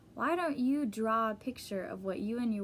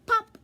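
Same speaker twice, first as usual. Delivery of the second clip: much higher, much louder popping sound; loud pop; 12 semitones higher, 11 dB louder than usual. Acted. The worm pops out of his hole with a loud high pop. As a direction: burst it out like a cork popping.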